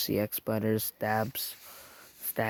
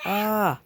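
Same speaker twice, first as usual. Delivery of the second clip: scared cry; 10 semitones higher, 5 dB louder than usual. Acted. Gavin gets scared and falls.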